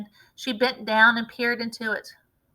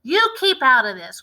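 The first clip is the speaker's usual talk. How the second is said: louder sharp retort to his wife; cried out; 3 semitones higher, 8 dB louder than usual. A narrator's reading. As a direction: brush her off loudly. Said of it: "cried Mister Cranky".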